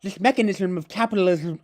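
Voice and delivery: put-on high, loud lisped foreign accent; effortful philosopher caricature; Zizek. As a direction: push each word through a thick lisp. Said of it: "it's difficult".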